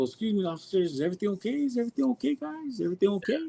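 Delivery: overly polite nervous. Retold or imitated. Nervously checking with police officers.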